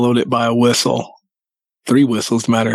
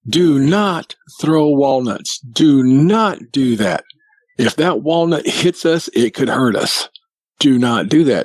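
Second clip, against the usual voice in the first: slow, emphatic retold scolding, pitched up; getting after them; 3 semitones higher than usual. Man scolding Bigfoots for throwing walnuts, getting after them. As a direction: lay down the law, word by word. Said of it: "I was getting after them".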